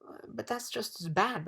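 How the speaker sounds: a touch of puzzled protest. A narrator's reading. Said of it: "protested Milo".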